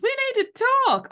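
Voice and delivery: excited. Shown as a demonstration. Above the line reading of the message, high and gleeful. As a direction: chirp it out with delight.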